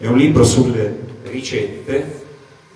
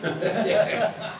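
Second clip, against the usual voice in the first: laughing; 11 semitones higher than usual. Real, not acted. Laughs with the interviewer.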